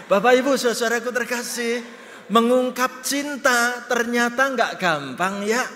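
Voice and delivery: high preaching appeal. Acted. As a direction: lift the voice and plead with them. Preacher addresses congregation.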